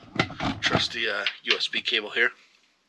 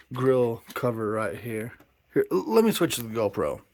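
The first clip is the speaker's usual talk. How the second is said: usual speaking voice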